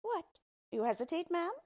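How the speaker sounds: high, loud dwarf's challenge; indignant cry. A narrator's reading. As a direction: exclaim in theatrical disbelief. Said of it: "cried the dwarf".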